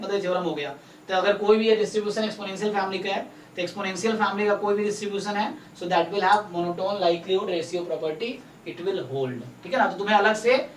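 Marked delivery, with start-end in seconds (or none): 5.7-9.6 slow, word by word, for notes; dictating pace; slow dictation. Shown as a demonstration. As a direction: spell it out slowly so they can write. Tutor dictating theorem statement.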